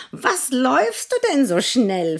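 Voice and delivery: hohe, laute Hahnenstimme; Hahn. Acted. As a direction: gackernd fragen. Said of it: "fragte der Gockel".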